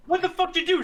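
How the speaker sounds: high, loud stunned disbelief; shocked outburst. Real, not acted. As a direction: blurt it out in shock. Friend reacts to story.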